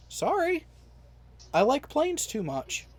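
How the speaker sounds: small, high-pitched mock-meek reply; sheepish apology. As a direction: act out a sheepish answer to a tirade. Meek retold answer to recruiter's tirade.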